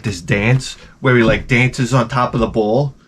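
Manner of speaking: ordinary delivery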